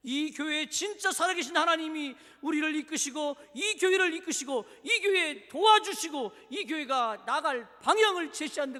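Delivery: loud, high, driving exhortation; full-force preaching. Real, not acted. Preacher urging church to trust God's leading, forceful preaching. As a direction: hammer each phrase to the congregation.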